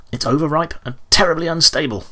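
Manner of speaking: high-pitched, nervous threat; shaky bravado. A narrator's reading. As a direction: bluff bravely while scared. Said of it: "trying to sound braver than he felt".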